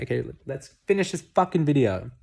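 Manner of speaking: a brisk, loud burst to get going; impatient push. Real, not acted. Hurrying to wrap up before phone dies.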